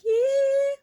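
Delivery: very high, loud yee holler; mountain-music yelp. Retold or imitated. Imitating the Deliverance-like score, high yelp. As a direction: yelp it like crazy hillbilly music. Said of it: "it almost sounds like Deliverance music".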